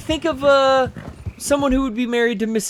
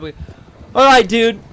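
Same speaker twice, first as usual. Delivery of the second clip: casual Valley dude; 3 semitones higher, 6 dB louder than usual. Retold or imitated. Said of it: "I talk like a Valley girl".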